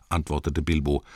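ordinary delivery, nothing marked

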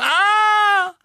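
high, loud shriek of fright; startled scream. A narrator's reading. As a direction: scream as if something popped up beside you. Twins appear suddenly mid-sentence. (erschrockener, schriller Aufschrei)

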